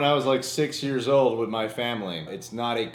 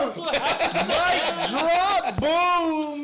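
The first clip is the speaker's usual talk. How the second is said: mock triumphant shout; 13 semitones higher, much slower than usual. Real, not acted. Joking triumph after crude joke.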